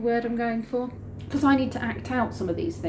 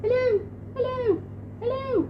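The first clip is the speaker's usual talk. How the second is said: tiny, very high-pitched voice; mimicked high, faint caller; little voice. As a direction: squeak it like a voice from a hidden earpiece. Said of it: "I can hear this voice going".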